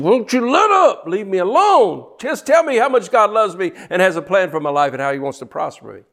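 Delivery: mock-whining hearer begging for comfort; whiny listener. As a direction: mimic a fed-up churchgoer. Mocking an annoyed listener.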